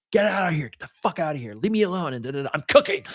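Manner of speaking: high-pitched mock yell, held back in volume; retold yelling. Cook snapping at partner who tries to help, mock yelling. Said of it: "I was yelling and screaming".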